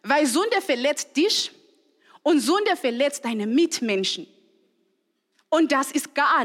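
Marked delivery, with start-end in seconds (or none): none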